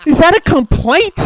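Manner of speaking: high, slow, mock-baffled question; incredulous. Real, not acted. Mocking the landlord to cohost, high and incredulous. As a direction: ask it like it's absurd.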